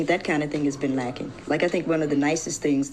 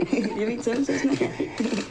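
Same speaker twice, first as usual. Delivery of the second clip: laughing through the words; high, giggling reply; 7 semitones higher, slower than usual. Real, not acted.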